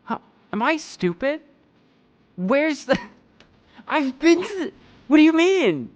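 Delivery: high, loud incredulous outburst; loud, high-pitched disbelief, as if the landmark were stolen. Real, not acted. Incredulous about the missing landmark.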